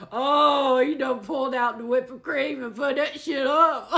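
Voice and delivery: high, teasing, laughing through it; laughing tease. Real, not acted. Reader teasing the reformed playboy, laughing. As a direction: tease him while cracking up.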